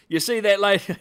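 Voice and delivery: loud, high-pitched Aussie impression; hammed-up Australian, on the edge of laughing; Australian accent. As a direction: play him as a loud Australian. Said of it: "oh, he's Australian".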